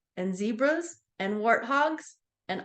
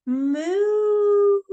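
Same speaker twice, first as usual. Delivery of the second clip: high, loud playful moo; cow moo; 12 semitones higher, 7 dB louder than usual. Pretend. Teacher playing cow for kids.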